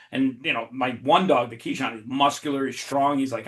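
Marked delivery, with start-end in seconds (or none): none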